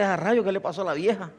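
quiet, chuckling retold remark; laughing aside. Preacher laughing at his younger self's remark, quiet chuckle. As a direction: tell it laughing under your breath. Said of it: "Yo dije".

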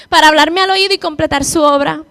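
ordinary delivery

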